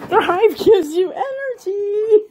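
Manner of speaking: high, slow, laughing voice for the dog; mock dog voice. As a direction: put words in the dog's mouth, squeaky and giggly. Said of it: "He says".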